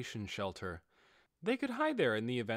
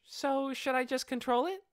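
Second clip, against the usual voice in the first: high, bright-voiced young girl; a mischievous kid answering breezily; playful dark elf girl; 13 semitones higher, 3 dB louder than usual. Aura answering how long she hid, high playful girl.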